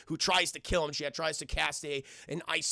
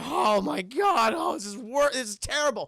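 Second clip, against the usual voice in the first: mock dismay; 5 semitones higher, 5 dB louder than usual. Kids losing all their toys, mock-sad lament. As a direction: wail it lightly like upset kids. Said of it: "kids are just so sad".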